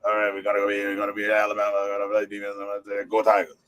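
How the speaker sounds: low, run-together Cajun coach voice. Retold or imitated. Imitating an LSU coach's team talk, low Cajun voice. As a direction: drop low and jumble the words, Cajun. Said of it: "any videos of Coach O".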